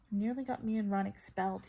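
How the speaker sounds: plain reading voice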